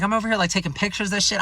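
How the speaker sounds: usual speaking voice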